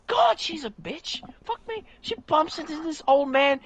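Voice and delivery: high-pitched exasperation; scandalized. Real, not acted. Fan appalled at how Wonder Woman behaves, scandalized. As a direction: pitch soaring in appalled disbelief.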